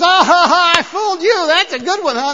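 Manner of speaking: loud, high mock laughter; gloating prankster dad. Dad mocks the son he just fooled, gloating laughter. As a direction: crow over the prank with a hammed-up laugh. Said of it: "And he says".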